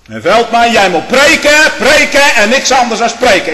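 furious rebuke. Retold or imitated. Telling Veldman off before the council, in a loud furious shout. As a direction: thunder it at him from the pulpit.

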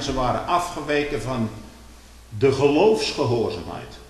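ordinary talk

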